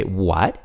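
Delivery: WHAT with pitch climbing to the end; rising tone. Shown as a demonstration. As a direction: lift WHAT as a question. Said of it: "your tone naturally rises".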